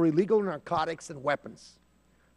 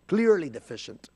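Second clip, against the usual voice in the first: usual speaking voice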